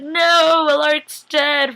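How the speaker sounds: high, loud, drawn-out protest; mock freak-out. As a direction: wail it in hammed-up panic. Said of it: "freaking out, because then I started".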